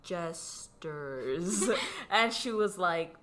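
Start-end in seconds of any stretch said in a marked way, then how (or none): 0.0-1.7 'Jesters' drawn out slowly, syllable by syllable; really slow. Retold or imitated. Retelling how she spelled it out for the director, really slow. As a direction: stretch 'Jesters' into two long, separate parts. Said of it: "like, really slowly".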